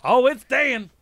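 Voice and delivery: hammed-up Texan accent; Texas Rich Dan. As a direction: introduce yourself as a Texan high roller. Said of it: "So I've got a Texas Rich accent".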